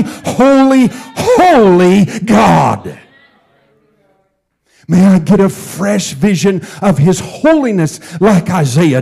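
loud, rising pulpit preaching, each line hammered home; full-force preaching. Acted. Pastor urging the congregation to true worship, in loud fervent preaching. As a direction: preach it out, building to a shout on holy.

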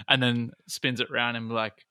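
normal talking voice

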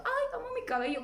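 high, soft gushy voice; mock gushing. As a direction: gush in a high little voice, mockingly. Mocking an overnight change of heart.

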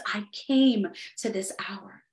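hushed whisper of Jesus' words. Retold or imitated. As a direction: drop to a whisper for the resolve. Quoting Jesus, voice drops.

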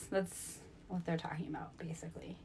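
breathy whispered aside; whispering. Real, not acted. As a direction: drop to a whisper. Aside after reading verse.

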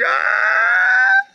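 long, very high fake bawl; loud hammed-up cry; mock crying wail. Host pretending to cry for a viewer, mock bawling wail. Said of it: "I'm crying".

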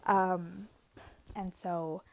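ordinary delivery

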